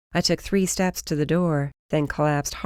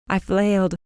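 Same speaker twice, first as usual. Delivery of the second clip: usual speaking voice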